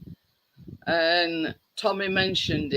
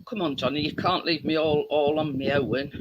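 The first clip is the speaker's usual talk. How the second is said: ordinary delivery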